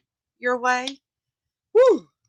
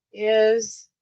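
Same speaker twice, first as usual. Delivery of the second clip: ordinary talk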